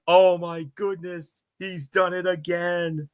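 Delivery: high, slow, booming-style sportscaster call; Chris Berman. Retold or imitated. Recreating his own Super Bowl field goal call, sportscaster impression. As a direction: stretch it out in a big announcer impression. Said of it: "channeled my inner Chris Berman by saying".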